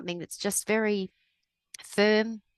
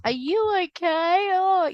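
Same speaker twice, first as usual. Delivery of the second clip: high, slow, syrupy fake sympathy; mock pity voice; 12 semitones higher, 6 dB louder, slower than usual. Mocking a pitying friend who asks if she's okay, high and drawn out. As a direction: overdo the pitying concern. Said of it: "gives me that sad pity face".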